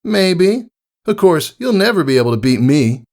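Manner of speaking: high, playful tomboy pony; teasing Rainbow Dash. A narrator's reading. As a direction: roguish tease to her pupil. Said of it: "A roguish smile".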